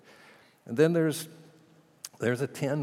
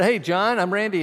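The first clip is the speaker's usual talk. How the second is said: bright, louder, higher hello; retelling an eager hello to a stranger; cheerful greeting; 9 semitones higher, 8 dB louder than usual. Retold or imitated. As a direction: greet him warmly and eagerly. Greeting John at the table.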